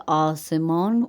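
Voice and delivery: آسمان slowly as "aw-se-mawn", clear final n; Persian full n. Shown as a demonstration. Teacher introduces Persian sky to Urdu speakers, full n pronunciation. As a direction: say آسمان without nasalizing the ending. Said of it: "بس فارسی میں نون غنہ نہیں ہے".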